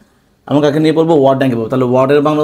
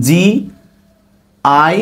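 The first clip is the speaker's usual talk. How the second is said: drawn-out spelling; 2 semitones higher, much slower than usual. Shown as a demonstration. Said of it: "বিওওয়াই".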